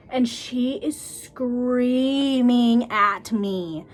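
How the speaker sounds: SCREAMING stretched out very slowly; emphatic drawn-out stress. Real, not acted. Stylist telling of an angry client, slow incredulous emphasis. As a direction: draw out "screaming at me" word by word.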